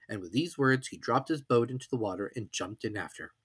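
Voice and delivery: ordinary delivery, nothing marked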